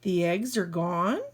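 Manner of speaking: ordinary delivery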